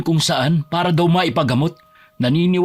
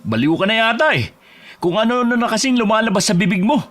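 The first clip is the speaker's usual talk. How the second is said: higher-pitched, scornful outburst; indignant; 7 semitones higher than usual. Acted. Husband rejects friend's talk of a spell, indignant. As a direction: throw it back at him, indignant. Quarrel over accusation against wife.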